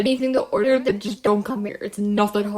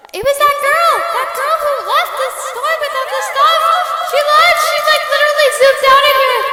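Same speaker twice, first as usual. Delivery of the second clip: frantic accusation; 11 dB louder than usual. Acted. Accusing the girl who fled.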